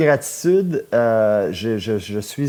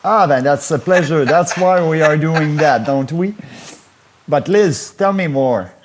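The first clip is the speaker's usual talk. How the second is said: excited host; 3 semitones higher, 4 dB louder than usual. Real, not acted. Host answers flirty caller.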